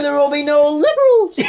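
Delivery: high cartoon shout. Shouted parody line.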